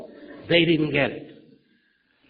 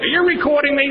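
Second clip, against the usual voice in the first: much higher and louder, squeaky mock panic; mock alarmed question; 12 semitones higher, 6 dB louder than usual. Sponsee asks the sponsor if he is taping, mock squeak.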